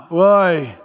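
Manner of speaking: put-on low, reluctant teen reply; teenage son. Teenage son questioning being told to put clothes on, low and reluctant. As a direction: answer like a teenager told to get dressed. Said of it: "over your teenage son's bedroom".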